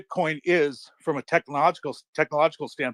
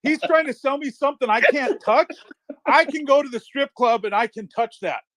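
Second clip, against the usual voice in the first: high-pitched mock dismissal; worked-up, higher-voiced incredulity; retold scoffing disbelief; 8 semitones higher than usual. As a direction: play your younger self scoffing at a pitch. Retells his dismissive younger self.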